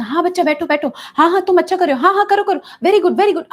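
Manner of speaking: high, loud gushing encouragement; over-eager praise. Shown as a demonstration. Therapist shows overexcited praise to a child on a swing, loud and high. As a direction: pile on the cheering to a child on a swing. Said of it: "हम ही इतने".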